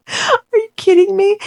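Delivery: high, loud outraged disbelief; loud, high are you kidding me; incredulous. Real, not acted. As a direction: shriek of disbelief at the absurdity. Outraged at her answer.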